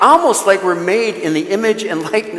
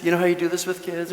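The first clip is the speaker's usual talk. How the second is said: usual speaking voice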